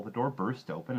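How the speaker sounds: ordinary delivery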